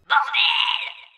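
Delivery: high, loud frustrated yell; exasperated shout. Real, not acted. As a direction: blow up in exasperation. Exasperated outburst at comment.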